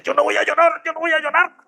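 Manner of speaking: imitando a un niño, voz muy aguda; niño castigado. Retold or imitated. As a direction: imita a un niño que se resiste a llorar, agudísimo. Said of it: "cuando uno le daban, uno decía". (voz aguda de niño castigado)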